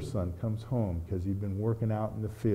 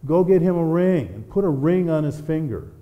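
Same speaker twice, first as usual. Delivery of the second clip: commanding father; 5 semitones higher, 7 dB louder than usual. Acted. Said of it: "the father said".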